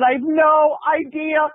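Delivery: high, slow mock wail; hammed-up cluelessness. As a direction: stretch it out high and theatrical. Actress admits she is clueless.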